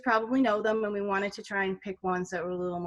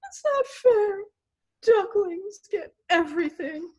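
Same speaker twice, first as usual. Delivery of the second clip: high, loud mock-tearful whine; hammed-up sulky tantrum, high voice; whining pigeon; 9 semitones higher, 4 dB louder than usual. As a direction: wail like a jealous pigeon.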